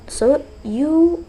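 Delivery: ordinary delivery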